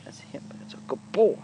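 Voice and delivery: slow, hushed whispered praise. Real, not acted. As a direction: breathe it to the horse, slow and soft.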